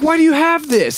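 high, loud mock outburst; squeaky incredulous lawyer impression; exasperated lawyer. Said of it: "He's like".

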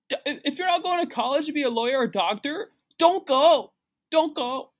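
high-pitched, pushy guru impression; hammed-up motivator giving orders way up high; Gary V. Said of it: "Some of the business ideas he gives these kids".

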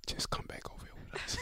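quick hushed whisper; imitated whisper. Teammate calling player back from an argument, whispered. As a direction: whisper as if coaxing someone away. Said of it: "Just do a whisper".

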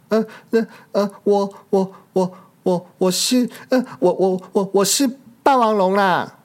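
a scared little pterosaur lying to a T. rex; scared stammer. Acted. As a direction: trip over every word in fright. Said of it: "小翼龙吓了一跳".

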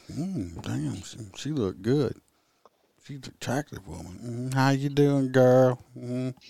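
soft, appreciative come-on; imitated flirting. Coworker admiring the bus driver, softly imitated flirting. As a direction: murmur it like a mock flirt. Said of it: "flirt flirting".

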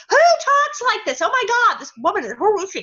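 high, loud disbelief; incredulous outburst. Retold or imitated. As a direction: play up the bewildered outrage. Acted disbelief at the script.

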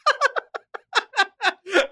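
high-pitched burst of laughing; real laughter. Real, not acted. Host amused by guest working out the years, high laughter. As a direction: crack up in a high giggle.

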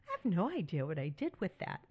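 tossed off fast, lightly amused; quick quote. Retold or imitated. Telling widows she still says this, quick and offhand. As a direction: rattle it off fast and casual. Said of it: "things that I still say".

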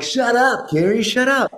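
hammed-up Aiden snapping at his roommate. Practicing Aiden at home, telling roommate off in a high bro voice. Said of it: "everything goes up".

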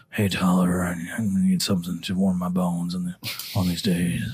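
lightly voiced knight asking for a drink; Sir Alden's voice. Knight greeting the barkeep, lightly voiced low. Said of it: "and he sits down at a stool in front of it and goes".

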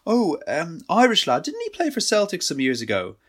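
unsure, halting guess; hesitant. A narrator's reading. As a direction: hedge your way through it. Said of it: "Tony said hesitatingly".

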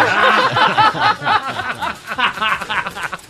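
high laugh. Real, not acted. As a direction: laugh at your own joke. Laugh after punchline.

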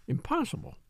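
soft, high mutter of disbelief; astonished mutter. A narrator's reading. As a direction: let it slip out quietly, high and amazed. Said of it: "he muttered".

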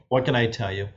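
ordinary talk

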